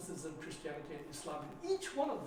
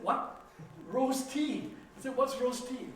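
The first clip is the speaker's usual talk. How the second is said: puzzled surprise; 6 semitones higher, 6 dB louder, slower than usual. Retold or imitated. Puzzled about rose tea.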